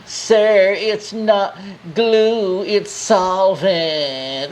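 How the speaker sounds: salesperson correcting. Retold or imitated. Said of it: "the salesperson's like".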